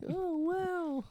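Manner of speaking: high surprised "wow"; high falsetto amazement; mock wow.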